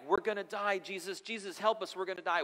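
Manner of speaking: high-pitched mock panic; acting out a panicked plea for help; freaking out. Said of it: "they're freaking out".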